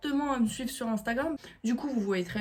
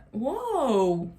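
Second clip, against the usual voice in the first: raspy just-woke-up voice; hoarse voice. Real, not acted. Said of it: "plus virile que les mecs au réveil".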